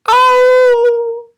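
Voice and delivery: very high loud yelp; pained cry. Acted. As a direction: yelp from the door. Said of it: "cried the wolf".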